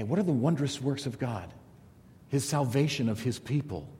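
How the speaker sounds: normal talking voice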